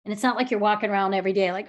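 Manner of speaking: ordinary delivery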